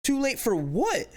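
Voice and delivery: high, disbelieving question; can't believe what he just heard; incredulous. Real, not acted. Incredulous at cop's words.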